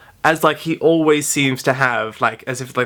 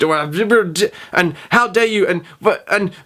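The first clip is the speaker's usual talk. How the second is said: slow, higher sputtering outrage; sputtering fury; 6 semitones higher, slower than usual. Retold or imitated. Furious teacher sputtering at the student, angry slow outrage. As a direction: splutter with rage, barely forming words. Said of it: "so angry she could barely form sentences".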